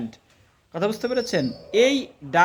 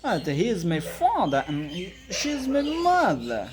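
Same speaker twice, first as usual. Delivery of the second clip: slow full model; much slower than usual. Shown as a demonstration. Teacher gives the student the full sentence to copy, very slow. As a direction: stretch the whole sentence out for them to copy. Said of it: "Right, like that".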